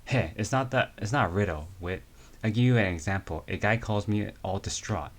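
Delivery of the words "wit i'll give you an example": ordinary delivery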